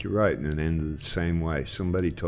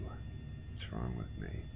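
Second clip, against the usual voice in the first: whispered self-rebuke; 5 semitones lower, 14 dB quieter, slower than usual. Real, not acted. Scolding himself after a mistake.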